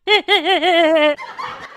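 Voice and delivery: hammed-up laugh, rising; forced laugh on cue. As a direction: laugh on demand, overdone. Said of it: "हंस देते हैं".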